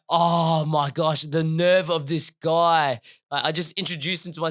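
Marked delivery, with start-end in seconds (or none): 0.1-3.0 slow, loud, drawn-out indignation; retold outrage. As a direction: stretch each word, acting out how appalled you were. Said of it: "I was so mad in that moment".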